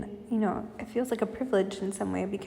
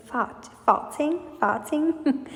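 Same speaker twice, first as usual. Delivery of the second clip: imitating an Italian roommate saying farting, higher and slow; Italian accent; 6 semitones higher, much slower than usual. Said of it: "she's Italian. I love her accent".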